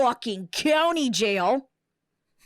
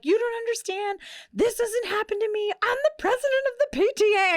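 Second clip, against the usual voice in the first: much higher, frantic retold plea, speeding up at the end; panicked pleading; 10 semitones higher than usual. Arrested woman begging the officer in panic, high and frantic. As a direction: imitate yourself begging the cop in a panic, voice climbing. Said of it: "I have a full-blown, full-blown panic attack".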